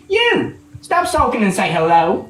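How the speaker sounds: sharp high "You!" then a nagging tone; mother telling grandpa to stop sulking; scolding call. Acted. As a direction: snap at the grumpy old man.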